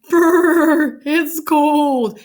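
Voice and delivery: loud, high, trilled "brrr" shiver; freezing shiver. A narrator's reading. Girl just pulled from icy water, shivering loudly. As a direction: shiver loudly through the words. Cheryl comes freezing out of the hole.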